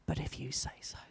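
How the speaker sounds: soft whisper, low and resigned. Retold or imitated. Peter answering Jesus after the empty night, whispering. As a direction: breathe it out like reluctant surrender. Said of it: "I just think he whispered it".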